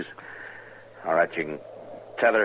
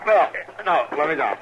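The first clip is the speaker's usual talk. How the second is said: high, louder pained cry; 10 semitones higher, 3 dB louder than usual. Acted. Injured marshal begging Chester to set him down, pained cry. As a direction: gasp it out as the leg gives way.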